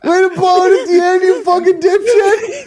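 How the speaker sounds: high laughing, near tears; cracking up at yourself; laughing hard. Real, not acted. Laughing at own blunder.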